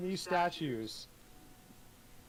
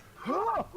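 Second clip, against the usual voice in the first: sharp gasp; 4 dB louder than usual. Acted. Action narration.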